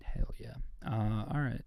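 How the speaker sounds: whisper. Acted. Late night whispered cheer.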